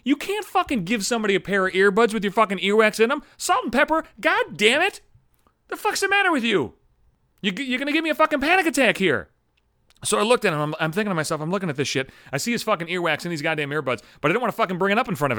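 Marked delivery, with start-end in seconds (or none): 0.1-9.3 very high-pitched exasperated rant; acted, near-panicked indignation; outraged scolding. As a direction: berate a coworker, voice pushed way up. Imagined outburst at the coworker.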